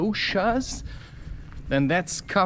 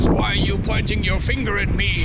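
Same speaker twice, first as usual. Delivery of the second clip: mock-angry challenge, lightly acted; 3 semitones higher, 3 dB louder than usual. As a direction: challenge someone in a mildly put-on angry voice. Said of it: "you hear this angry voice".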